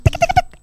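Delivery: quick soft tongue clicks; rapid ticking sound effect; web-tying ticks. Acted. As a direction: tick away softly as the web winds. Imitating web being tied.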